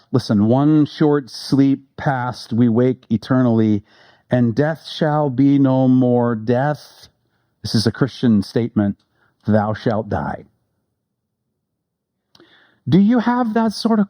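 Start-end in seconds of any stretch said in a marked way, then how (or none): 0.5-7.1 very slow, low, solemn recitation; solemn poem reading. Acted. Preacher reading the sonnet's end to the church, low and slow. 9.5-10.5 very slow, low, solemn recitation; solemn poem reading. Acted. Preacher reading the sonnet's end to the church, low and slow.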